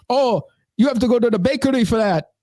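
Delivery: high, exaggerated Caribbean deli worker; imitated Caribbean accent. Deli worker directs customer to the bakery, imitated Caribbean accent. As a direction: send them off in a mock Caribbean accent. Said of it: "this is the way she said it".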